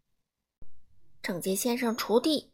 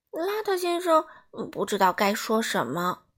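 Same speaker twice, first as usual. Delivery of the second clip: ordinary delivery, nothing marked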